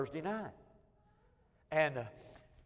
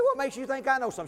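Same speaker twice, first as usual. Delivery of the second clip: mock innocent protest; 8 semitones higher, 6 dB louder than usual. Wife asks him for cuss words.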